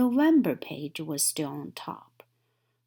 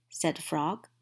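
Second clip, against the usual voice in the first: ordinary delivery, nothing marked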